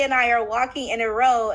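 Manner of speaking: normal talking voice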